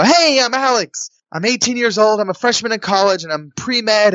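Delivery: high-pitched, keen self-introduction; eager introduction. Retold or imitated. Contestant introduces himself to the producer, eager high-pitched pitch.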